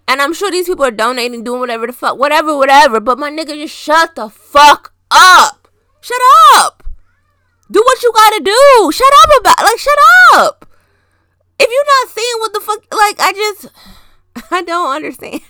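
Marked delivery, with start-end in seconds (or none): 3.5-13.2 loud, high shout of shut up; ranting at celebrities, shouting them down; exasperated yelling. Real, not acted. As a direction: yell it at them, fed up. Rant at showy celebrities.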